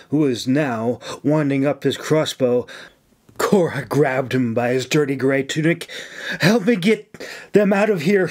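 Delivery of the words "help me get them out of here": raised-pitch, pressing order; firm order with some urgency; urgent command. A narrator's reading. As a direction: command a helper under fire. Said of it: "she commanded".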